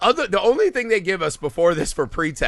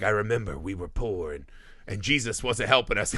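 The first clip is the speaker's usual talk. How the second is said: put-on much lower, softer weary old man; imitating the old drunk remembering poverty; 9 semitones lower, 8 dB quieter than usual. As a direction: mumble it like a washed-up drunk. Old drunk recalls hard past.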